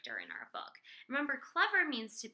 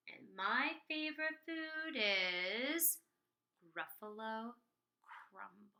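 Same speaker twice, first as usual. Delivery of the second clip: slow, suspenseful, falling to a near whisper; sly mouse threat; slower than usual. Acted. Mouse slyly threatens Gruffalo.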